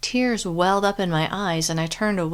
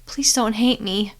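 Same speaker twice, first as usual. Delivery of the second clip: tearful shaky; 4 semitones higher than usual. A narrator's reading. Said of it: "my voice shook with threat and tears".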